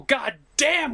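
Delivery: ordinary delivery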